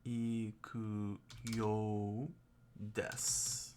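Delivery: slow sounding out. Shown as a demonstration. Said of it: "Ikiyo desu".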